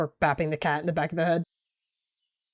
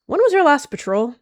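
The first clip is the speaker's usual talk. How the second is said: loud, high demanding question; sharp demand; 10 semitones higher, 10 dB louder than usual. Acted. Leader demanding of her sister, loud and sharp.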